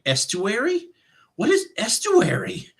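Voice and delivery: high-pitched, mock-puzzled questioning; baffled. Narrator recalling a list of unknown dialects, baffled. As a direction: puzzle over an unknown word, pitch leaping up.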